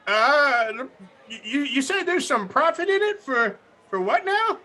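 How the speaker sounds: put-on high confused old granny. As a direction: play a hard-of-hearing granny. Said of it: "do my best Granny impression".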